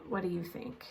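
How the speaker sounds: ordinary delivery, nothing marked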